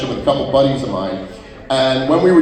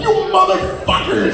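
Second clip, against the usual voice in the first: high outraged curse. Retold or imitated. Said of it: "I'm like".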